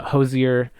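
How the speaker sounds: ordinary delivery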